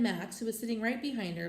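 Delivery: ordinary delivery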